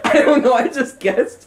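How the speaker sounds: high-pitched, laughing repeat of a quote; cracking up. Real, not acted. Friend retells his silly test answer, cracking up. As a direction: repeat it through real laughter.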